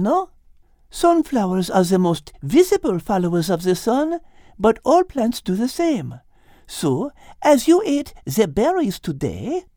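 put-on high French-accented teacher voice; french chef. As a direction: teach it like a cheerful French cook. Liz's lines.

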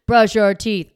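imitated parent snapping "Brush your teeth." loud; mock yell. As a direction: drop the sweetness and bark it.

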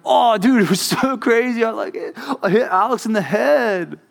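put-on high, hyped-up kid bragging; gleeful kid imitation.

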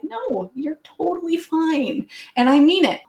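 high, drawn-out mock reassurance; over-sweet "no, totally fine"; exaggerated reassurance. Acts out reassuring the apologetic friend.

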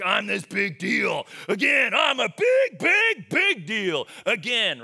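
mock the players full of themselves; mocking big shot. Players bragging in the drill, hammed-up shout. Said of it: "they're all like".

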